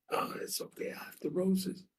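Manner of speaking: whispery, tearful self-comfort; acted crying. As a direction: play a crying woman consoling herself. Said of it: "and she's crying".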